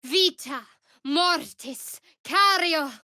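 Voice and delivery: high, slow, otherworldly chant; spell incantation. Acted. Companion casting a spell in battle, slow ringing chant.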